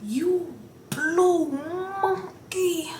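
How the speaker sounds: high, very slow sneering taunt; imitating a taunting scream, high and drawn out; mock scream. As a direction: drag out each word to mock her. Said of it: "Screaming in the door".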